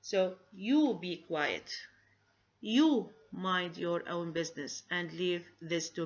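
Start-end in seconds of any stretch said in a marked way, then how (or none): 0.0-1.7 "you" stressed and drawn out, slow. Shown as a demonstration. Teacher showing students the stress on "you" in a command. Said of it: "here the subject you is going to get stress".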